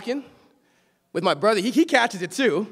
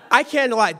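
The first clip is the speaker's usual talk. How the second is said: ordinary talk, nothing marked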